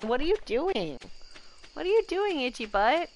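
high, playful talking-to-the-pet voice; pet-scolding. Real, not acted. Owner annoyed by their pet, playful high scolding.

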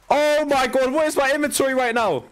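high-pitched, exasperated wail at the game; whiny high-pitched disbelief; exasperated outcry. Real, not acted. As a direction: cry out in high, incredulous despair. Exasperated at his loot.

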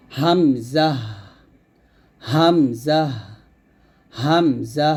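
হামজা started in the throat, long syllables; Hamza from the throat. Shown as a demonstration. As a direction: bring হামজা out slowly from the throat. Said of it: "সিফাত আমাদের follow করে".

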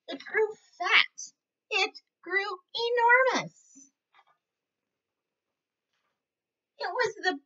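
lively storybook voice for little ones; reading to children. A narrator's reading. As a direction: read it like a teacher at story time. Reads picture book to kids.

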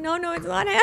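high, strained half-laughing sob of a plea; pleading through tears that he's innocent; teary protest. Retold or imitated. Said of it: "hysterically crying".

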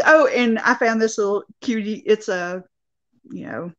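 ordinary delivery

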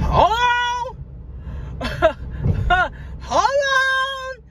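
high squealing hold on through laughter; laugh-cry it, voice jumping up; laughing excitement. Real, not acted. Driver squeals to her viewers, laughing hard.

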